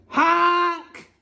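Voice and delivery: high, drawn-out truck honk; yelled honk. A narrator's reading. Reader voices the dump truck, yelled honk. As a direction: honk like a big horn. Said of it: "yelled the dump truck".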